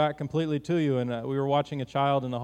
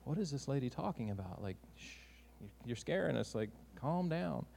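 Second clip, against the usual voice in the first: soft, whispered shh, slowing to calm her; hushed shushing; 11 dB quieter than usual. Retold or imitated. Boxcar passengers shushing the screaming lady, soft and hushed. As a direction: hush her down gently so the kids settle.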